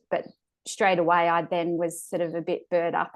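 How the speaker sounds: usual speaking voice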